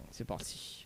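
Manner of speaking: whispered aside; whispering. Real, not acted. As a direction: whisper it under your breath. Aside off mic.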